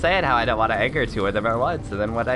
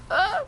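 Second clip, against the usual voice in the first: sudden high-pitched yelp. Real, not acted. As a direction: yelp in alarm. Near death in fight.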